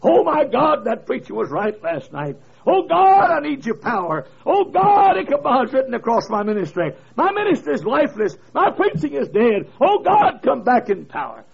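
Oh God cried out high and loud; sobbing plea for power; a crying preacher begging God; crying preacher praying. Retold or imitated. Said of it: "and he was crying".

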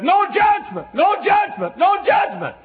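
high, loud triumphant shout; great shout. Retold or imitated. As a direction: shout it like Paul's victory cry. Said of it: "with a great shout".

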